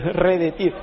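"redetir" with r and d swapped; "redetir", mock muddled syllables; mimicking mispronunciation. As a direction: mimic someone saying "redetir" for derretir. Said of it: "bueno, estaba remedando".

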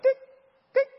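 very high beep imitation; monitor beeps. Preacher imitating the monitor as she revives, high beeps. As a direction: mimic the heart monitor coming back.

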